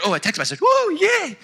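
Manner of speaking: squealing high mock glee over a text; hammed-up delight at a phone buzz; mock excitement. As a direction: play up a silly thrill. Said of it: "we normally get distracted".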